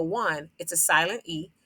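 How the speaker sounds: ordinary delivery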